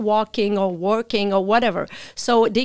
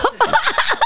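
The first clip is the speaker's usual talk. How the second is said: high giggle; embarrassed laugh; 3 dB louder than usual. Real, not acted. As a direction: laugh at talking too much. Laughs at own rambling.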